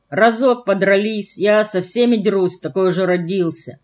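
ordinary delivery

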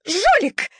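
high yelped accusation; nervous squeal. A narrator's reading. Nervous Shaiba squealing an accusation at the puppy, high yelp. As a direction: yelp it like a frightened lapdog. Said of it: "взвизгнула нервная Шайба".

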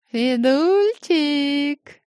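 slow high purring call; cat folk. A narrator's reading. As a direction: purr the name like a cat. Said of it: "замурлыкала та".

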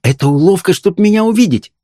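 put-on high-pitched, lovestruck girl; adoring excitement. A narrator's reading. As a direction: gush to the beloved, sure of being loved. Lovestruck girl embracing her beloved.

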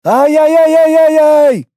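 high, drawn-out panicked wail; yelling at full voice. A narrator's reading. Faking an out-of-control machine to draw attention, yelling at full voice. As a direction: yowl it out loud and high. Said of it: "заорал он, что есть мочи".

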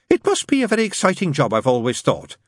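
high, eager nun voice; chatty nun. A narrator's reading. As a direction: make eager small talk up high. Nun makes small talk.

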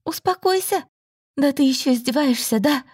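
high, incredulous echo of his word; indignant mockery. A narrator's reading. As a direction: throw the word back in disbelief. Mocking his word back.

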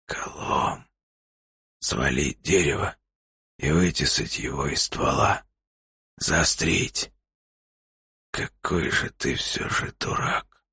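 hoarse, slow, soft rasp; wheezy voice. A narrator's reading. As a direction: wheeze out the instructions weakly. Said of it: "просипел Илья".

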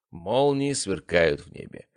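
plain reading voice

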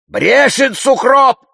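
high, drawn-out shouted denial; straining yell. A narrator's reading. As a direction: yell it at the top of your voice. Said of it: "надрывается Зухур". (надрывный крик)

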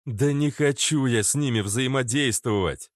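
almost shout it in refusal; near shout. Acted. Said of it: "почти выкрикнул Владимир". (почти крик)

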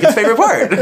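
laughing retort. Retold or imitated. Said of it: "I'm like".

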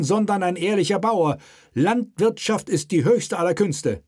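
high, sharp royal proclamation. Acted. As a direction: cut through the room with it. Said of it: "hob seine Stimme und machte sie gleichzeitig schneidender".

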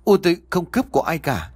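high, frantic pleading denial; panicked plea. Acted. Daughter begging the official, panicked and high. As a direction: defend her mother in a rush of panic. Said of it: "Huệ hốt hoảng lắm".

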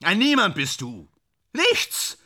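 high, strained tirade; bitter agitated outburst. Acted. Actor ranting about bad actors to his friend, agitated. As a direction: hurl out each verdict, pitch soaring. Ja, beruhige dich.

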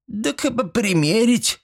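high, slow, innocent granny voice; blithely unbothered high-pitched reply; nonchalant old woman. A narrator's reading. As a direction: state it coolly in a high, drawn-out pitch. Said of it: "спокойненько так заявила бабка".

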